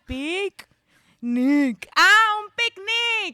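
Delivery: put-on high, slow, loud Brazilian accent. Roommate explaining the word in a high Brazilian accent. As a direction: drag out un picnic like the roommate. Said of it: "she had to write".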